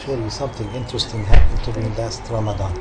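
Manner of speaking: ordinary talk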